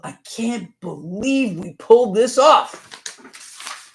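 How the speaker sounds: smug gloating voice. Retold or imitated. Mocking the politician's gleeful look, drawn-out smug gloat. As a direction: savour every word like a smug winner.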